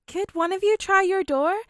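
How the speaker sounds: high, loud call through the glass; shouted through the car door. A narrator's reading. Calling through car window.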